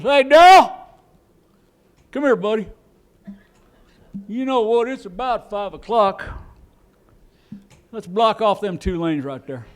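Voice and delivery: high, hollering country foreman; hammed-up rural road-crew boss. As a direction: call a buddy over, then lay out the plan. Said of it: "some road foreman every night".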